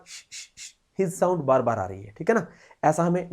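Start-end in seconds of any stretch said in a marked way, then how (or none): 0.1-0.8 short voiceless "sh" hisses in a row; soft, separate sibilant bursts; repeated hiss. Shown as a demonstration. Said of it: "ऐसी sound".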